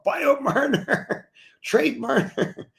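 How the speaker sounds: high, drawn-out mock wail; hammed-up fan outcry, slow and very high; mock panicking Toronto fans. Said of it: "what happens in Toronto".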